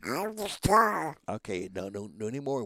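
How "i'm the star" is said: high, drawn-out duck squawk; boastful cartoon duck; Webster. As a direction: squawk it slowly as a show-off duck. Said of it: "it is Webster's voice".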